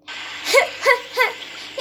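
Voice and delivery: high, staged chuckle; short acted laugh in separate bursts; demon's laugh. A narrator's reading. Demon scoffing at the fisherman's doubt, laughing. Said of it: "دیو خندید و گفت".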